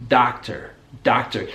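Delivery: "doctor" said dahk-ter, with ah; American "doctor", open unrounded vowel; American pronunciation. Shown as a demonstration. Teacher repeating what learners just heard, American pronunciation. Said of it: "replaced it with the ah sound".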